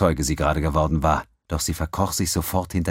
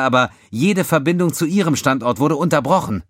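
higher, louder commanding officer; brief the lieutenant with authority; captain voice; 10 semitones higher, 5 dB louder than usual. A narrator's reading. Captain Katami voiced on comm.

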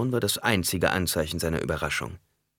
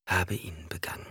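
hushed confession, much softer; whispering; 6 dB quieter than usual. Acted. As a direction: let it fall to a soft whisper. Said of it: "flüsterte Jessica".

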